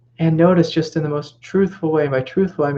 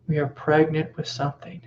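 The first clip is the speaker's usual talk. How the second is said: ordinary delivery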